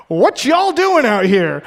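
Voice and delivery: put-on a thick Texas teen calling from his truck in a Texas accent. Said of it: "the most Texas accent is like".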